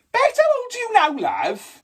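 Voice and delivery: very high sing-out to a dog; high-pitched pet-owner voice; calling to a pet. Pretend. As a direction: coo a question at your dog, sky-high, dropping on the last word.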